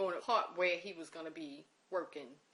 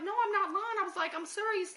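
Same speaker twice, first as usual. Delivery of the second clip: high, loud insisting; urgent insistence; 13 semitones higher, 6 dB louder than usual. Retold or imitated. Insisting to the disbelieving uncle that it's true, high urgent.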